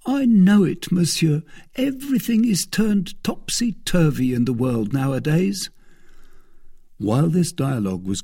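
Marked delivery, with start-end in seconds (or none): 0.1-5.7 mildly woeful, high-pitched servant; sighed lament. A narrator's reading. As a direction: lightly bemoan it in a raised voice. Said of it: "sighed Bazin".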